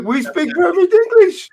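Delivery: put-on a jokey send-up of a Dutch accent in English; mock Dutch accent. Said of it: "his thick Dutch accent".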